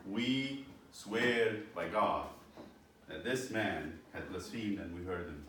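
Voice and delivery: very slow, solemn swearing; false witnesses swearing before the court, very slow; solemn oath. Acted. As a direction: drag out every word of the oath.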